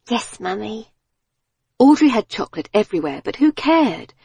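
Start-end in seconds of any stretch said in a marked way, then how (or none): 0.1-0.9 put-on small, soft child's voice; lightly voiced small daughter. A narrator's reading. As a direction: answer softly as a little girl. Small daughter answering her mother.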